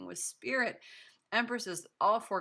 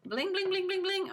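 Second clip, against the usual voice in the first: much higher, louder sing-song bling; excited squeal; 13 semitones higher, 4 dB louder than usual. Real, not acted. Tarot reader thrilled by the cards for pile four, high excited bling. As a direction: squeal with delight over the cards.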